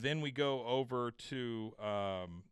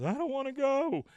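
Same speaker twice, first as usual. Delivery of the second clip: whiny protest; 14 semitones higher, 6 dB louder than usual. Retold or imitated. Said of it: "He goes".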